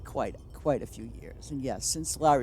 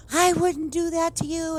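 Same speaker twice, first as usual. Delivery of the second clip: high, mocking imitation of the other woman; mocking her voice; 14 semitones higher, 4 dB louder than usual. Mocking the neighbour who denies eavesdropping, high mimicry. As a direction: mimic her innocent denial, pitched way up.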